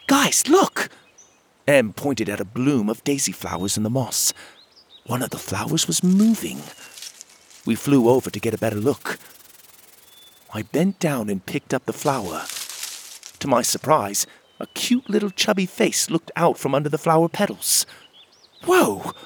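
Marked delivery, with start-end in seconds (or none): 0.1-0.9 very high, eager call to friends; excited call. A narrator's reading. Em spots moving flowers. 18.6-19.1 high, delighted whoa; surprised. A narrator's reading. Sees tiny face in flower.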